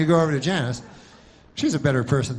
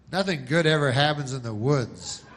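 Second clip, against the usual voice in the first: ordinary talk, nothing marked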